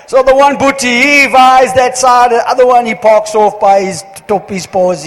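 loud and high, imitated Indian English accent; Indian dialect. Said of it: "but in the Indian dialect".

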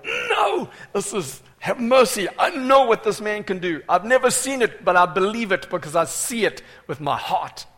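loud, high, urgent plea; crying out. Acted. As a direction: open on a yell, then keep pleading forcefully. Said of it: "You begin to cry out the more".

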